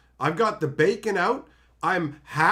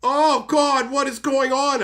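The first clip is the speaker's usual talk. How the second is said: alarmed; 6 semitones higher, 5 dB louder, slower than usual. Retold or imitated. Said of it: "So I'm just like".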